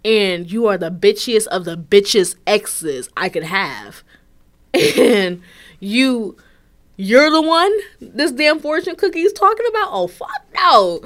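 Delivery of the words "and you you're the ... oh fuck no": appalled outburst that slows on the refusal; outraged disbelief. Real, not acted. Outraged at ex's message.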